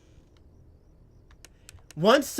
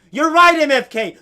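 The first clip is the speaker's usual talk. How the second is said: excited shout; 7 semitones higher, 9 dB louder than usual. Real, not acted. Agreeing loudly with a chat viewer.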